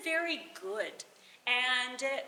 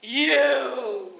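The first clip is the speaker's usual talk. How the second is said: angry accusing cry; 7 dB louder than usual. Acted. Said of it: "and she goes".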